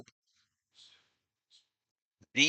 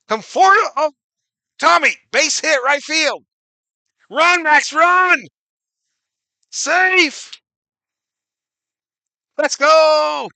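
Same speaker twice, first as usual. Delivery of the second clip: high, loud, thrilled play call; excited shouting; 12 semitones higher, 8 dB louder than usual. Real, not acted. Streamer calling the base hit to fans, excited shouting. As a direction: yell the hit home as it happens.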